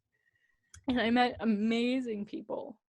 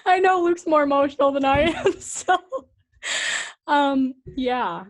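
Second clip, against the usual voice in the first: teary laugh; 6 semitones higher, 11 dB louder than usual. Real, not acted. Laughing through tears on podcast.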